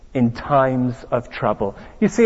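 ordinary delivery

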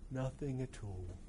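soft, slow, gloomy monotone; intoned dismally. Acted. Depressive robot Marvin to the tank, slow soft dismal drone. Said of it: "intoned Marvin dismally".

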